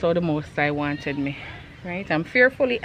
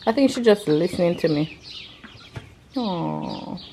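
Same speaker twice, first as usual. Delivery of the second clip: ordinary talk, nothing marked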